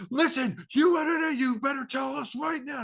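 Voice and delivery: high-pitched, loud hectoring shout; mock angry yelling. Mimicking the movie's endless yelling, angry shouted demand. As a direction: yell it like an overwrought film character making demands. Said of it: "it was the yelling".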